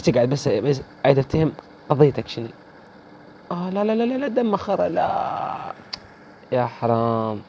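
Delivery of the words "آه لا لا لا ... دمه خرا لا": much higher, breaking complaint; squeaky protest as the enemy survives; high whiny no. Real, not acted. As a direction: whine in disbelief. Despairing no during the fight.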